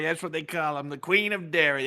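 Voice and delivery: high put-on voice kept in a dry aside; lightly voiced caricature. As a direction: stay slightly in the caricature voice. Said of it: "we do the voices".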